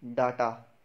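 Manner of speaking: "डाटा" as DAA-taa; long "aa" in first syllable of "डाटा"; common mispronunciation. Shown as a demonstration. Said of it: "डाटा नहीं है".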